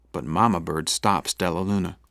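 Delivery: plain reading voice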